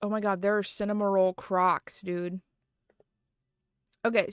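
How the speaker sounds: ordinary talk, nothing marked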